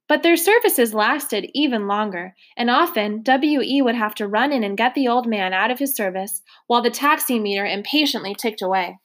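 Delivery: ordinary delivery, nothing marked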